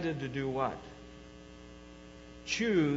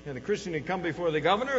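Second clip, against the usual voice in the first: ordinary talk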